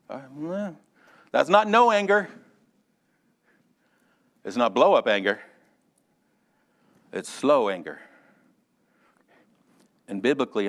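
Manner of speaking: ordinary talk, nothing marked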